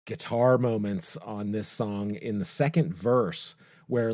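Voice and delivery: ordinary delivery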